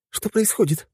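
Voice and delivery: put-on high girlish voice; Helga, alarmed; young woman voice. A narrator's reading. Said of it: "спрашивает Хельга".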